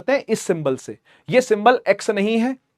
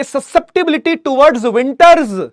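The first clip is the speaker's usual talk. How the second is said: slow emphasis; 6 semitones higher, 5 dB louder, much slower than usual. Shown as a demonstration. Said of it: "तो हम बोलेंगे".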